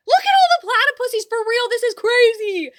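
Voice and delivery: high, very loud burst of excitement; excited shouting. Real, not acted. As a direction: yell it out, thrilled and amazed. Thrilled reaction to gifted subs.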